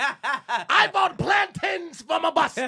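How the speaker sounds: loud, high, drawn-out mimicry; exaggerated island bellow through laughter; mock dancehall shout. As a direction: belt it slowly, imitating a bad Jamaican chant. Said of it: "Pat came in".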